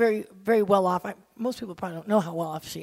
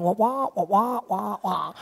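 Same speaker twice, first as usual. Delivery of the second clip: nonsense wah-wah noise; mimicked meaningless babble; imitated "wah-wah" gibberish. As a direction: go "wah, wah" like unintelligible talk. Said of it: "look at me like I'm going".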